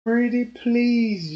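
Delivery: slow, drawn-out plea; retold begging, words dragged out; desperate pleading. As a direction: beg with a stretched-out "pretty please". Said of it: "I was desperately telling everybody".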